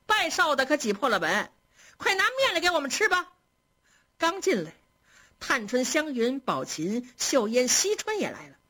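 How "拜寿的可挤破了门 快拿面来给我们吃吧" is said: high-pitched excited maids, lightly voiced; playful high-pitched demand with a smile; cheerful clamouring. A narrator's reading. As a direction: call out merrily for birthday noodles, pitch climbing. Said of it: "都抱着红毡子笑着说".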